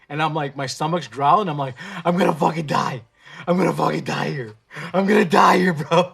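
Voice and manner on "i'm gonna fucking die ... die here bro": loud panicky cry of doom; frantic, loud, half-joking panic; panicked yelling. Retold or imitated. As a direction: yell it like you're sure you'll die of hunger. Panicking while fasting, self-talk.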